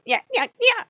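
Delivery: high mocking yeah yeah yeah; mocking smartass. Loki taunting her during a play fight, high and mocking. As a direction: taunt like a cheeky brat. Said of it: "being a smartass and going".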